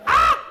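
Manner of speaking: very high, loud short shriek; child's scream. Retold or imitated. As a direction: imitate a kid shrieking like a crow. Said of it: "Why are we screaming?".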